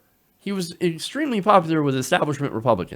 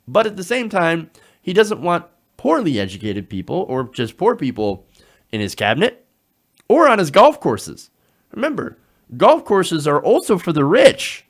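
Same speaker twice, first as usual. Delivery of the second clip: ordinary delivery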